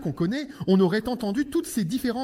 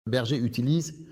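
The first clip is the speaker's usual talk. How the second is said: lower, measured sermon voice; preaching; 10 semitones lower than usual. Acted. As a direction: preach with weight.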